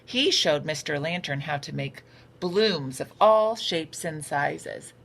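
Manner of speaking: ordinary delivery, nothing marked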